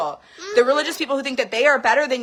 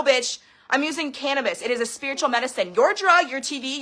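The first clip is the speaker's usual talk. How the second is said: ordinary talk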